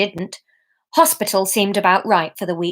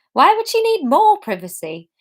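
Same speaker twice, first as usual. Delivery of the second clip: ordinary delivery, nothing marked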